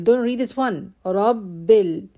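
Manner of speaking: ordinary talk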